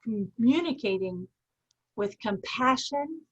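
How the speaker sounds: slow, deliberate affirmation with long pauses; a calm, measured self-affirmation. Shown as a demonstration. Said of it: "So your command is".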